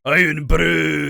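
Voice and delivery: imitating the brother's shouted answer; sudden shout. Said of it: "what actually came out was just".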